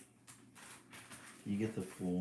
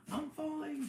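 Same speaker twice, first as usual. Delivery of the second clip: very high mock shout; baby bird shouts as it falls again. A narrator's reading. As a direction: squeal it as you fall. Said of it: "shouts Baby J".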